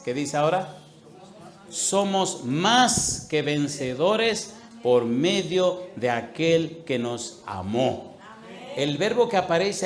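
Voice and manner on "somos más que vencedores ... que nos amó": drawn-out, loud verse reading; slow solemn emphasis. Real, not acted. Preacher reads Romans eight thirty-seven to his congregation, slow and loud. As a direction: lean on every word of the verse.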